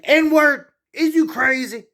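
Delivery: high, loud angry shout; sudden furious yell at someone acting crazy; angry outburst. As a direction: yell it like you've lost patience. Voicing his own angry outburst.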